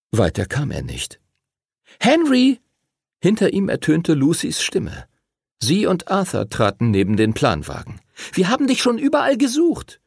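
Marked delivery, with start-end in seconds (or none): 2.0-2.6 put-on high, louder girl's call. Lucy calls to Henry from behind, high calling out. 8.3-10.0 put-on very high-pitched child character; urgent girl's voice. Lucy finds Henry after searching, high urgent voice.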